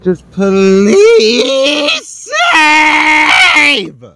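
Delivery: anguished cry. Real, not acted. Gamer pleading with his game, real anguished crying shout. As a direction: wail the plea in despair.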